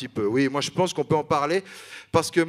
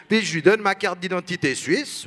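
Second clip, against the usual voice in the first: normal talking voice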